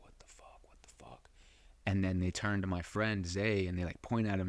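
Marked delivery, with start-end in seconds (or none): 0.0-1.3 stunned "What the fuck?" whispered under the breath; whispered disbelief. Retold or imitated. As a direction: breathe it out, barely voiced. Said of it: "And I remember, like".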